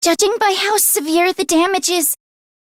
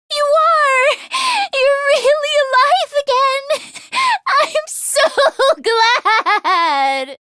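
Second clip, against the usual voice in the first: laughing with joy; 6 semitones higher, slower than usual. Acted. Overjoyed reunion, laughing through it.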